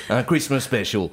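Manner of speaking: Christmas special said the English way; English accent French. Pretend. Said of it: "j'ai un accent anglais".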